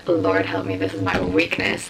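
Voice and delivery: mock deep voice. Confessing her book weakness to viewers, mock low voice. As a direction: drop into a comic low voice of defeat. Mock despair at hidden art.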